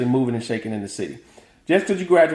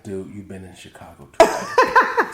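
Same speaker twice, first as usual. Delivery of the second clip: lower, slow mock family scolding; low, drawn-out teasing from family; family's voice; 8 semitones lower, slower than usual. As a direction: imitate the folks back home teasing. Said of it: "my family was like".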